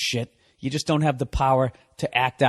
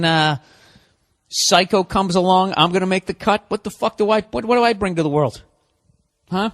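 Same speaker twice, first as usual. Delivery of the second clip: usual speaking voice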